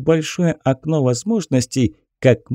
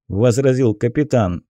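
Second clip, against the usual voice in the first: usual speaking voice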